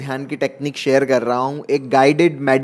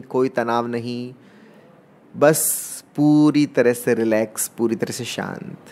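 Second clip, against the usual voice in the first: guided meditation. Acted. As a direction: draw out key words to pace each breath. Leads guided meditation breathing round. (धीमे, शब्दों को खींचते हुए ध्यान का निर्देश)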